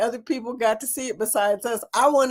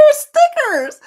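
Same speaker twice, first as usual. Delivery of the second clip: much higher, louder gleeful cry; delighted squeal; 6 dB louder than usual. Real, not acted. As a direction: squeal the word. Cracking up at stickers.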